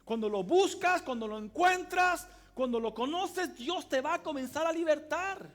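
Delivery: full-force preaching. Acted. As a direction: drive it home to the congregation. Preaching climax. (predicación con toda la fuerza)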